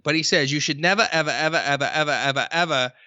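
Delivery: repeated ever, ever, ever, each one leaned on; quoting a speaker, stacking up ever after ever; stretched-out never ever. Retold or imitated. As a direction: pile up the evers for emphasis. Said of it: "I believe he says it like this".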